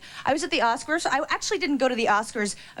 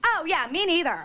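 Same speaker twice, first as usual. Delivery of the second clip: ordinary talk, nothing marked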